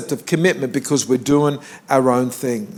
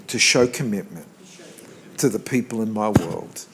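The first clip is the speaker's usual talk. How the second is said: softer, lower prayer line for the congregation; prayer to repeat; 6 semitones lower, 6 dB quieter than usual. Shown as a demonstration. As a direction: lead them gently in a short prayer. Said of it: "I want you to say this".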